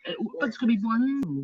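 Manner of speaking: high, singsong false cheer; mock optimism. Co-host parodies Brexit promises, mock-hopeful. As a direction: insist on it like a naive believer.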